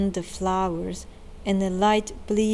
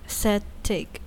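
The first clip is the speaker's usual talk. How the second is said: plain reading voice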